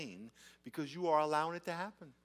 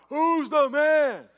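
high, drawn-out holler; imitating a loud, hearty long-distance yell; yell across parking lot; 11 semitones higher, 12 dB louder, much slower than usual. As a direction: shout it to someone far off. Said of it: "someone will yell across the parking lot".

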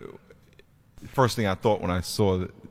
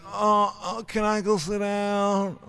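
put-on high, slow pitiful kid asking; whiny kid; 11 semitones higher, much slower than usual. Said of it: "the other kid's like".

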